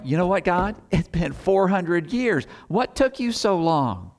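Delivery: high, whiny complaining voice; imitating a complainer grumbling at God, high and whiny; mock grumbling. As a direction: pitch it up and whine at God impatiently. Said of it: "She's not grumbling or complaining".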